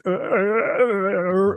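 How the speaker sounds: long slurred mock-drunk groans; drunken mumbling impression. Host imitating a drunk regular for the panel, slurred drone. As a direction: mimic a wordless drunk drone, drawn out slowly. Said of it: "who talks like this".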